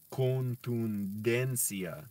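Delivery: contundencia stretched out, a clear D in den; con-tun-DEN-cia, never tensia; very slow careful. Shown as a demonstration. Learner correcting a slip, saying contundencia with a clear D. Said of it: "not tensia".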